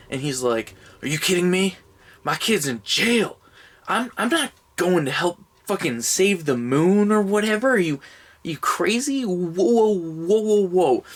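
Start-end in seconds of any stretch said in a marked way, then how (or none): 1.0-11.0 high, incredulous comic whine; indignant nervous guy backing away; mock-panicked refusal ending in whoas; Ben Stiller impression. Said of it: "It's Ben Stiller as Moonfall Man".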